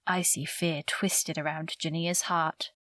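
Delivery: plain reading voice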